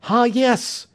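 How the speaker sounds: high cry of discovery; excited cry. A narrator's reading. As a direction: call out as the clue turns up. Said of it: "he cried".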